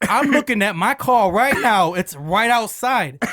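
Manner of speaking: loud, high, drawn-out indignation; offended. Retold or imitated. Coworker insisting to prank caller about his car, offended. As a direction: insist slowly, imitating an offended man. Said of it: "he's getting offended because he goes".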